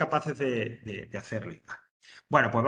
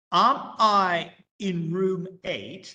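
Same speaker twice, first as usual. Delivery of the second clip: slow, clear English for learners; careful classroom English, word by word; slow model sentence; 5 semitones higher, 7 dB louder, much slower than usual. Shown as a demonstration. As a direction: spell it out slowly for the class. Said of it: "teacher models question".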